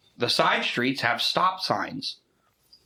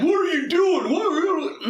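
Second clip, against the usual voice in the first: mocking high whine; 3 dB louder, faster than usual. Mocking the driver's reaction.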